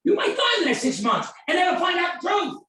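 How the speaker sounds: high full shout; shouted warning at the caller; yelling. Retold or imitated. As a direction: yell it like a burning bush. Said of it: "the way I yelled at him".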